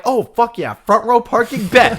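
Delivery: excited, lifted voice; pleased with himself. Retold or imitated. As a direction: celebrate a lucky find. Acts own delight at parking.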